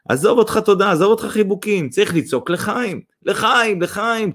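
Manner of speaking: high, rousing cheers; crowd shouting. A narrator's reading. Gathered villagers urge a toast, shouting. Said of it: "צעקו האנשים שהתאספו סביב".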